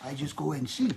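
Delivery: put-on French accent. Shown as a demonstration. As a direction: sign off in a mock French accent. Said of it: "I can do a French accent".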